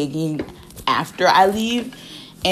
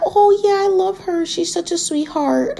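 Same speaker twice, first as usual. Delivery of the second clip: much higher fake-sweet voice; sugary act for the family; 14 semitones higher than usual. Nurse sweet-talking the family, fake sweet and high. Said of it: "she acted all sweet".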